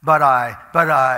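loud, sputtering protest; protesting shout. Retold or imitated. The doubter shouting back his protest to God. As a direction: blurt out the objection. Preacher protests, cut off mid-word.

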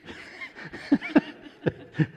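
light airy laugh at himself; high breathy laugh. Real, not acted. Laughs at own panic.

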